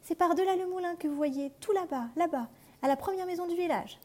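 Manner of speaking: put-on high little girl's voice. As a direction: point the way like an eager child. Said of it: "dit le petit chaperon rouge". (voix aiguë de petite fille)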